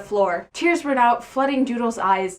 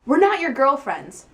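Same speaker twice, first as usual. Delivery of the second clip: higher, louder mild snap; angry snap; 6 semitones higher, 4 dB louder than usual. Acted. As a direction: snap back, a touch mad. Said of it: "Doodle snapped back madly".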